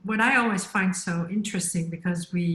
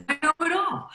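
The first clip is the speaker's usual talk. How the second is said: higher, smug know-it-all voice; mock arrogant man; 7 semitones higher than usual. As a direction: play a cocky man who knows everything. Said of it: "He'll just be like".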